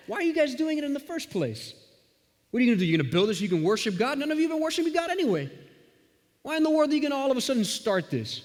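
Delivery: high, fast, scoffing taunts; badgering ridicule. Sanballat ridiculing the workers, high-pitched mocking taunts. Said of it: "he starts to badger and ridicule".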